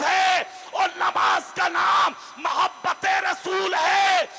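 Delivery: loud, slow, high preaching at full force; fiery preaching. Acted. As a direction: thunder it at the crowd. Preacher addressing gathering.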